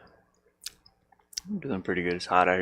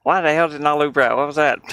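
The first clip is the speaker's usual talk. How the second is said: loud, higher exasperated yell; frustrated outburst; 6 semitones higher, 12 dB louder than usual. Real, not acted. Software glitch frustration.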